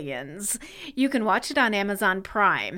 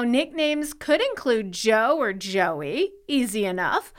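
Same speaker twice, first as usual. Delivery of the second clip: ordinary talk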